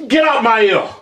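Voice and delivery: loud, angry yell at the noise. Real, not acted. Yelling at his cohost to get out of his ear, loud. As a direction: yell it hard.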